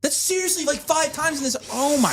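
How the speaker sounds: high-pitched frustrated outburst; tantrum. Real, not acted. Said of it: "It's like he's throwing a tantrum".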